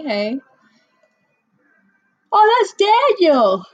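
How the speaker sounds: ordinary talk, nothing marked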